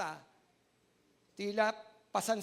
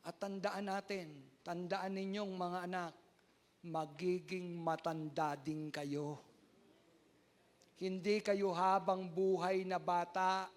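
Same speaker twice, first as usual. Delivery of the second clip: slow warning; 2 semitones lower, slower than usual. Real, not acted. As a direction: lay each word down slowly. Slow emphatic warning to children.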